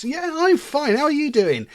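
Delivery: mock chirpy small talk; fake cheerfulness. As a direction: put on a fake smile and insist you're fine. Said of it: "this fake smile".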